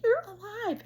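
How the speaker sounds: very high, loud disbelief; shocked outcry. Retold or imitated. Woman finding dead-seeming dog alive, high loud outcry. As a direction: cry it out in high-pitched shock.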